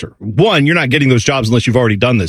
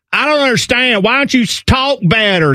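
mock complaint; 9 semitones higher than usual. Host mimicking someone scolding an accented speaker, mock indignation. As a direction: play the ignorant complainer, pitched up. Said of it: "when someone is speaking with an accent and they're like".

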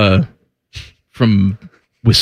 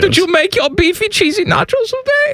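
put-on cooing baby talk; 14 semitones higher than usual. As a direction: talk to him like a toddler, all sugar. Cooing over a coworker.

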